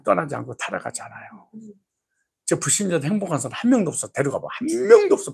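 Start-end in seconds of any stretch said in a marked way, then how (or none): 4.5-5.3 emphatic cry. Real, not acted. Preacher exclaims emphatically.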